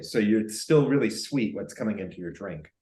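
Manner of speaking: ordinary talk, nothing marked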